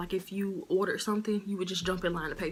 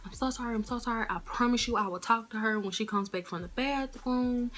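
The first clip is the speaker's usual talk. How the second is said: high, pleading apology; apologetic owner's wife; 5 semitones higher than usual. Retold or imitated. Owner's wife apologizing to an angry regular, high and pleading. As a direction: beg her not to worry. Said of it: "the lady was like".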